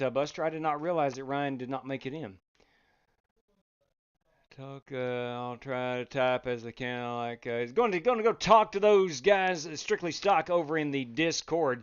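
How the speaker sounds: ordinary talk, nothing marked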